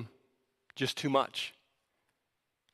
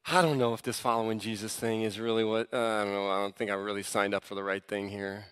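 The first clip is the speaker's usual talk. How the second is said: low, subdued admission of doubt; doubting inner voice; 9 semitones lower, 6 dB quieter than usual. Retold or imitated. Believer privately questioning following Jesus, low and doubtful. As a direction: sink lower and softer, unsure.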